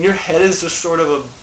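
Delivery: ordinary talk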